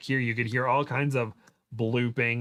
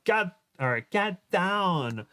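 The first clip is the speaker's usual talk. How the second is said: higher-pitched warning, only lightly shouted; mild shout; 7 semitones higher, slower than usual. A narrator's reading. Kim warns as the laser dot lands, mild shout. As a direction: call a restrained urgent warning. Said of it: "and she shouts".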